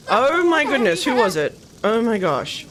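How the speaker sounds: loud, high burst of shock; alarmed outcry. Real, not acted. Gamer sees fire break out, alarmed outcry. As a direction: exclaim in real surprise at disaster.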